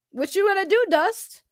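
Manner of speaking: the taunt that came out sounding sus; mock threat taunt. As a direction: lean into the dare without much push. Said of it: "That sounded awful. That sounded so sus".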